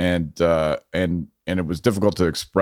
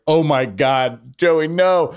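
higher, slow, drawn-out horror; shocked protest; 8 semitones higher, much slower than usual. Retold or imitated. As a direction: stretch it out, begging him to stop. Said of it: "I was like".

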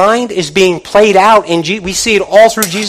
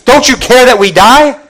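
louder, higher panicked cry; mock panic; 5 semitones higher, 5 dB louder than usual. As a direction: cry out like frightened disciples. They're panicking.

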